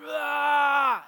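loud, high roar of fury; rage scream. Retold or imitated. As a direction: let out an enraged yell. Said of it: "just this rage starts coming inside of me".

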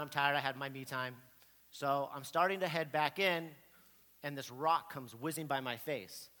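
ordinary talk

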